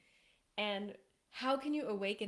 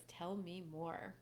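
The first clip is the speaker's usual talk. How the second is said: gentle slow tell me more; soft, slow invitation; 4 semitones lower, 6 dB quieter, slower than usual. Shown as a demonstration. Coach demonstrating the magic words to men, soft and slow. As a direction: say it softly and slowly, drawing her out. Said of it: "the three magic words".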